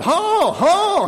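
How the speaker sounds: very high, loud holler; yelled command. A narrator's reading. Boy on an overturning sled, yelling at calves. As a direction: yell the steering order desperately. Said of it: "Almanzo yelled".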